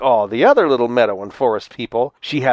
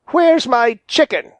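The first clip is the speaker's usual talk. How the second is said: high, indignant storybook demand; angry fox confronting a thief; snarled; 9 semitones higher, 3 dB louder than usual. A narrator's reading. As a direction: demand it crossly, pitched up. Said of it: "snarled old Granny Fox".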